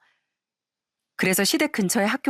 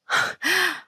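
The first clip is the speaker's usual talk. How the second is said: mocking laugh; 9 semitones higher than usual. Mocking laugh mid-quarrel.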